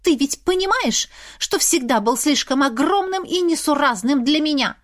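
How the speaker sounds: high, teasing schoolgirl mimicry; mock him like a snotty schoolgirl. A narrator's reading. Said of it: "передразнила его Лизавета, точно какая-то школьница".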